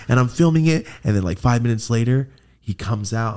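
normal talking voice

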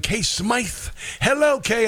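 a touch of British; slightly British. Real, not acted. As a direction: greet with a hint of a British accent. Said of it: "you always sound slightly British".

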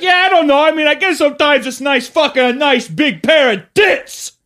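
high, loud aggressive yell; hammed-up aggressive shout. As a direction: snarl it at the front row. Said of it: "Like, very aggressive".

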